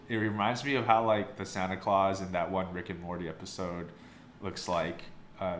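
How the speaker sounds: ordinary talk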